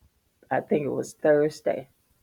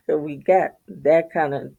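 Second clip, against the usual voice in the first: ordinary delivery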